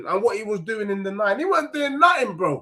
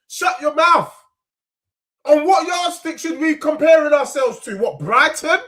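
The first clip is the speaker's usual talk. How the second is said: louder, irritated comeback; snapping back; 4 semitones higher, 5 dB louder than usual. Real, not acted. Fan answering critics in his chat, irritated and louder.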